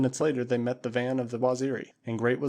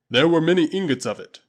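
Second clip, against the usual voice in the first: booming Waziri warrior; 4 semitones higher, 9 dB louder than usual. Acted. Usula answering his master Tarzan, loud and proud. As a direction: call it out like a loyal tribesman.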